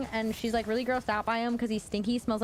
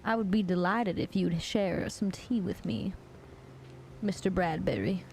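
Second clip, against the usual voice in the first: put-on genteel Southern belle accent; Southern belle making a courteous invitation; 3 semitones lower than usual. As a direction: play the polite Southern belle hostess. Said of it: "Is that, like, Southern Belle voice?".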